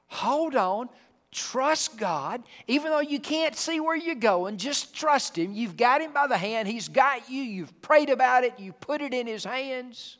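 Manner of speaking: high, loud pleading exhortation; rousing the congregation to hold on; urgent preaching. Real, not acted. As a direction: press it on them, urging trust. Preacher urging faith, raised.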